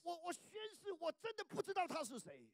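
high stammering oath; frantic oath. Retold or imitated. Preacher acts Peter stammering an oath, high and loud. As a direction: stammer then insist.

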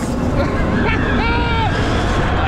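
high giddy laugh. Real, not acted. Laughing after the ride. (râs amețit, foarte sus)

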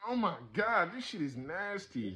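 high, loud revulsion fading out; disgusted. Real, not acted. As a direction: groan it out in disgust. Reacting to nasty flavor.

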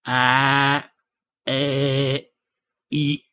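"Ah, eh, e" as drawn-out, separate vowels; "Ah, eh, e" held long in mock Cockney; mispronounced Cockney vowels. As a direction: imitate a pupil sounding out "Ah, eh, e". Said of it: "she couldn't pronounce vowels".

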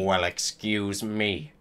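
low, quiet second character, mildly angry; understated anger. A narrator's reading. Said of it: "They both shouted angrily".

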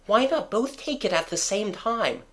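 put-on high-pitched girl's voice; Wendy's eager suggestion. A narrator's reading. Wendy offers her splendid idea, eager child voice. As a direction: pipe up with a helpful idea in a high voice.

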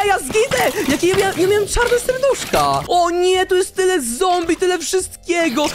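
high-pitched gamer panic; alarmed, squeaky exclamation; panicked outcry. Real, not acted. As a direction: yelp as your character nearly dies. Nearly dying in game.

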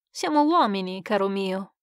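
ordinary delivery, nothing marked

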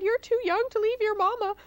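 high-pitched, pleading mother's protest; distressed mother crying. A narrator's reading. Mama Pig tells Hamlet she's too young to leave, crying. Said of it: "Mama Pig cried".

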